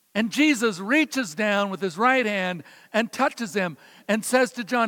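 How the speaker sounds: ordinary talk, nothing marked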